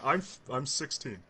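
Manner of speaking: ordinary talk, nothing marked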